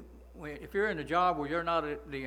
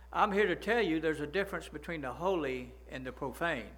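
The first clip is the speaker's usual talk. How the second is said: usual speaking voice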